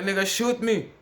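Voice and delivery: shouted defiance, high and loud; angry retort. Retold or imitated. To the man who threatened him, a loud defiant shout. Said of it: "I'm like".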